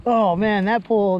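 normal talking voice

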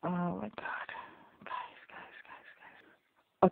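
hushed, unvoiced pleading; whispered dismay. Real, not acted. Viewer pleading with characters on screen, whispered dismay. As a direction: whisper it in dread, repeating yourself.

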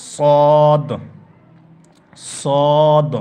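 সদ boomed out loud and heavy; loud classroom letter drill; emphatic sad demo. Shown as a demonstration. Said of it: "তারপরে আমরা চলে আসব".

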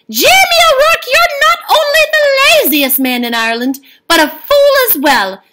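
very high, loud scolding shriek; furious wife. A narrator's reading. Eileen scolding Jamie for trading the gold, high furious shout. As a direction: tear into him like a fed-up wife. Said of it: "she was furious".

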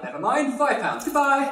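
high-pitched, hammed-up farewell; breezy getaway. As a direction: toss off a cheery exit line. Kean flees after failed act.

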